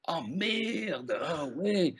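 high-pitched, drawn-out mock dismay; retold dawning realisation. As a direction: act out suddenly catching your own mistake. Retold sudden realisation of mistake.

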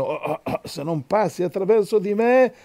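high, put-on impostor voice; mocking impression of a fraud preacher; mock religious swindler. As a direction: mimic a self-important go-between up high. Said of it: "attenzione agli imbroglioni che dicono".

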